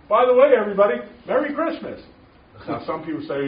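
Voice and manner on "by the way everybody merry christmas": high, loud holiday shout to a room; cheerful greeting call. Retold or imitated. Greeting the whole post office, loud and bright. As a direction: call it out to everyone. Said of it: "walking into the post office and saying".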